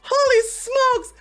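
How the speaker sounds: high shrieking laugh through the words; crying with laughter. Real, not acted. Reacting to Alec's clip, crying with laughter. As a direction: laugh till you cry. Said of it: "I'm crying".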